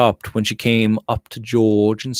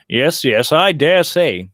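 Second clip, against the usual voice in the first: brusque, louder impatient reply; 5 dB louder than usual. A narrator's reading. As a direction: brush her off, eager to be home. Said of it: "he answered impatiently".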